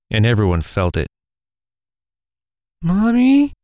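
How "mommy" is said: put-on high, small child calling her mother; little girl voice. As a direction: play a young girl tugging a sleeve. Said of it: "a little girl said".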